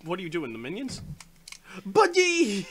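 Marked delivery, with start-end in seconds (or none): 1.8-2.7 high, loud movie-style yell; imitated call-out. Repeating a movie call to a puzzled friend, imitated shout.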